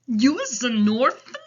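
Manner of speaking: loud, astonished giant voice; big storybook giant's surprise; Big Friendly Giant. A narrator's reading. Giant reacts to news.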